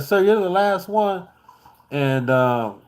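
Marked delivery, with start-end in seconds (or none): 0.0-1.3 high-pitched imitation of a female gate agent; put-on polite airline staffer, pitched up. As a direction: voice her well above your own pitch. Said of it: "she's like".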